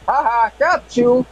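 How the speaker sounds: high, slow teasing reveal; gleeful gotcha. Retold or imitated. Prank caller reveals the trick to a friend, teasing high voice.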